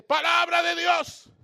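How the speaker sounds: imitating a prophetess's loud, high proclamation; slow, high shout announcing a message; anuncio a gritos. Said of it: "Y sale y me dice". (imitación de un anuncio profético a gritos)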